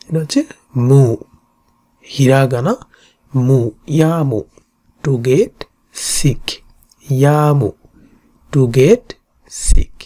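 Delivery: normal talking voice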